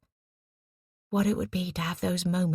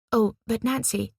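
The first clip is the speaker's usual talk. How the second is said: higher, lightly raised call; calling after her; 6 semitones higher, 4 dB louder than usual. A narrator's reading. Eliza to Nancy leaving the tent, calling after her. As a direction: call a friend back as she goes. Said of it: "calling after her as she ducked".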